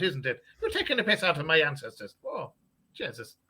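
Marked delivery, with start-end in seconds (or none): none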